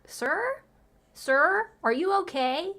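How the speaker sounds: high, rising questioning calls; mock concern. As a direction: check on him with exaggerated high-pitched concern. Acts out questioning the upset man.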